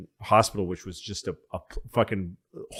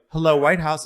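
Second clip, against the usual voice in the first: lightly voiced operator, slightly lifted; a routine, mildly chirped phone greeting; switchboard operator; 4 semitones higher, 4 dB louder than usual. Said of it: "It's a switchboard operator".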